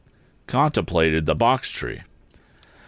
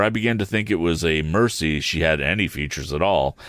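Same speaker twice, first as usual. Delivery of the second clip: ordinary delivery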